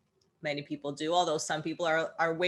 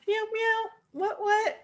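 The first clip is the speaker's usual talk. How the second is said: very high, playful meowing cat voice; cat imitation. Podcaster mimics the cat getting a few words in, high cat voice. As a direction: squeak it out like a chatty cat. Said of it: "your cat always needs to get".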